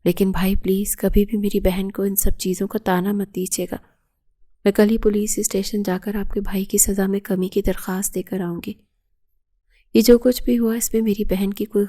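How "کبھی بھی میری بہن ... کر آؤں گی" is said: plain reading voice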